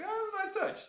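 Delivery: high-pitched fanboy delight; delighted reaction. Retold or imitated. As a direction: recreate a thrilled gasp of appreciation. Retells own delighted reaction to scene.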